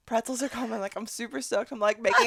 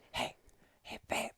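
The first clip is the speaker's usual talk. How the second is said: hushed, slowing complaint to a partner; whispered tattling; 5 semitones lower, 10 dB quieter than usual. Retold or imitated. Passenger telling partner the stewardess is mean, pitiful whisper.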